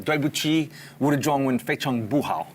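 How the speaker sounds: careful non-native Mandarin; an American speaking hesitant Mandarin; foreigner speaking mandarin. Shown as a demonstration. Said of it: "speak some Chinese for us".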